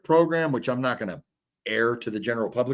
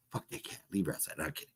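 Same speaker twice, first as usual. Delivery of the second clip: whisper; 9 semitones lower, 16 dB quieter, faster than usual. Retold or imitated. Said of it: "I'm like".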